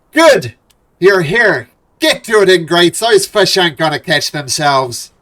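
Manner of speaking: loud, high barking boss dwarf; gruff dwarf shout. Acted. Dwarf foreman yells at the party to start fishing. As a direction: bellow orders at lazy workers.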